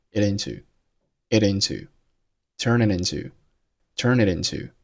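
it into with the t flapped to a quick light d. Shown as a demonstration. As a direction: tap the t of it into lightly against the ridge. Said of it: "可以做一个flap t".

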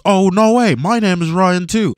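high, loud retold astonishment; excited quote, pitched well up; mock amazement. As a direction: imitate a friend thrilled by a coincidence. Retelling friend's reaction to namesake.

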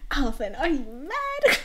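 loud, high, slow and drawn out; incredulous outcry. Acted. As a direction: stretch it out in hammed-up disbelief. Acted outcry that breaks into laughter.

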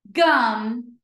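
GUM joined in one go; blended word. Shown as a demonstration. Teacher blending for pupils, GUM as one word. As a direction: say GUM whole and firmly. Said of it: "read each word fast".

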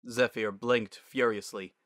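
usual speaking voice

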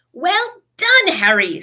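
high, hearty congratulation; delighted. A narrator's reading. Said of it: "looking delighted".

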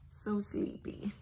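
soft whisper. Acted. Parent reading the sleepy line to the child, whispered. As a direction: hush as it drifts off. Chick asleep.

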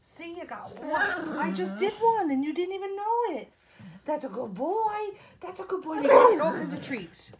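high, loud cheering for a pet; bright-pitched celebration; excited praise. Real, not acted. Praising pet after one nail.

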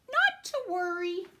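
put-on breezy boy. Boy reassuring parents, bright carefree call. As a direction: toss it off like a cocky kid. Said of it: "He's saying".

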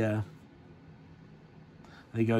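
ordinary delivery

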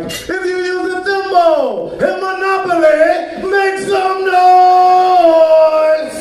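high, loud, stretched DJ call; hammed-up club DJ yelling to the crowd; club DJ shout. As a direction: hype the crowd, drawing out the last words. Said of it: "It'd be stuff like this".

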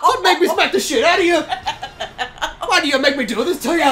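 a hammed-up Irish husband bullying; mock Irish accent; mock Irish threat. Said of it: "is that what they talk like?".

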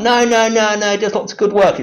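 high, loud mock protest; defensive believer. Believers defending the liar, high mock protest. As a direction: whine it like a gullible follower. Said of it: "They're like".

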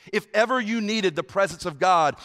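normal talking voice

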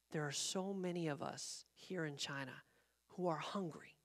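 soft, low, heartfelt; hushed earnestness. Retold or imitated. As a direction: confide it very softly. Retells young woman's earnest confession.

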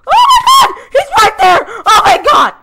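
high, loud shriek of surprise; startled scream. Real, not acted. Gamer shrieks when the enemy appears right beside him.

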